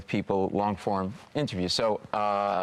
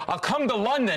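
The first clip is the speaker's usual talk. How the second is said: nervous blurt; 12 semitones higher than usual. Retold or imitated. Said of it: "And I go".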